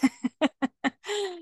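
high laugh; a self-mocking laugh; high amused laugh. Real, not acted. Laughing at her own habit.

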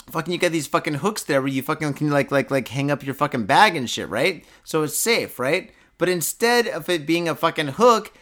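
ordinary talk, nothing marked

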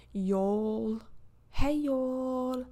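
British accent sliding toward Australian; amateur British accent, drifting Australian; attempted British accent. Shown as a demonstration. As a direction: try on a British accent and let it slip. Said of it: "every time I do a British accent".

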